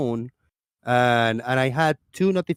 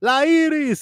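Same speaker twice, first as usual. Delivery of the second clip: high-pitched "La Iris" street call; imitated call to a neighbour, pitched high; 13 semitones higher, 3 dB louder than usual. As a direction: call it out far higher, Spanish "Iris". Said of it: "and we called it".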